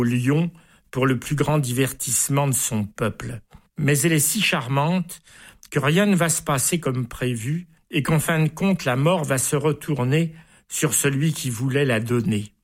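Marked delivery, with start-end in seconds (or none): none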